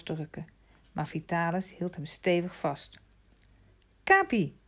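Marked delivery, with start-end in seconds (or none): 4.0-4.6 high, loud summons to a dog; calling out. A narrator's reading. Vitalis summons his dogs to guard the boy, calling out. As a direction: call the dog over, pitch leaping. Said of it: "riep hij".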